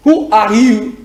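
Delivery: normal talking voice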